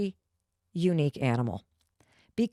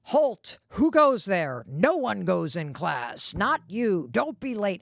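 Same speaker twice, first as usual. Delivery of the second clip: hammed-up strict barre receptionist; imagined strict desk clerk; slower than usual. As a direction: bark it like a palace guard. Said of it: "The person at the front desk".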